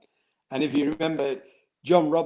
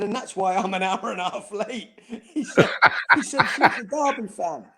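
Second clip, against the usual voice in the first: laughing; 6 semitones higher, 4 dB louder than usual. Real, not acted. Laughing through punchline.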